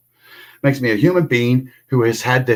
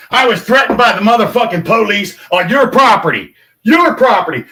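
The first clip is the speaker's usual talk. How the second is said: angry shout; 6 semitones higher than usual. Real, not acted. Blaming the governor's property, raised angry shout. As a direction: hammer the blame home.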